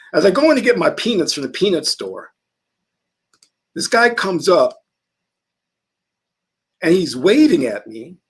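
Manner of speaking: ordinary talk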